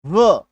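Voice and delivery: a clear, loud व sound for WH. Shown as a demonstration. Teacher repeats the WH sound for the class, loudly. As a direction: say व crisply, as a plain v/w sound. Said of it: "क्या होता है".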